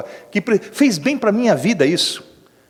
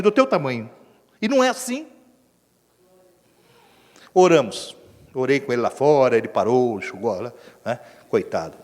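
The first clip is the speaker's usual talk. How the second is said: ordinary delivery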